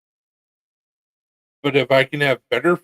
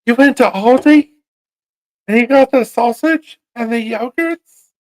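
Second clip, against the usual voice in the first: put-on high, loud Scottish girl voice; Scottish little girl; 13 semitones higher, 8 dB louder than usual. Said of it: "like those little girls from Scotland".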